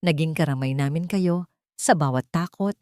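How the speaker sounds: ordinary delivery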